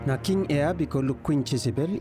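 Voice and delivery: usual speaking voice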